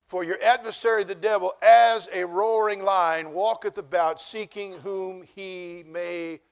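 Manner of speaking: drawn-out, very slow reading; slow scripture recital. Shown as a demonstration. Pastor recites the roaring-lion verse slowly to the church, weighty and drawn out. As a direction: stretch each word of the verse out. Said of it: "what, church?".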